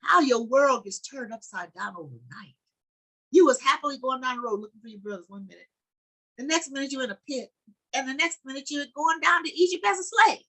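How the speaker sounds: stirring sermon climax; fervent preaching. Acted. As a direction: preach it up to the congregation. Preacher building to full force.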